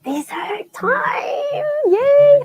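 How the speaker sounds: high, slow gleeful cheer; excited cheer. Real, not acted. Dessert arrives, cheering.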